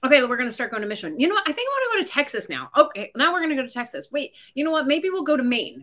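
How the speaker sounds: high, fast, flighty; change your mind on a whim; fickle traveler. Retold or imitated.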